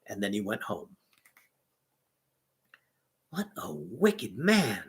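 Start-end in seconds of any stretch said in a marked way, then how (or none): 3.3-4.8 slow thought. A narrator's reading. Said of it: "thought Little Klaus".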